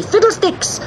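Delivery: high kitten shout, little added volume. A narrator's reading. Cat landing on the witch's shadow, high-pitched shout. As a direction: cry it out high and triumphant. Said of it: "shouting loudly".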